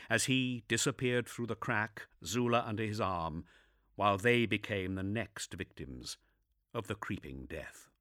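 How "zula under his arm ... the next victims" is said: ordinary delivery, nothing marked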